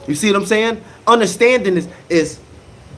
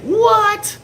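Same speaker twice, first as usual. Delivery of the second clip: squealed, much higher What; mock gasp of a gossiping girlfriend; shocked girlfriend. Man mimics his girlfriend hearing gossip, high squeal.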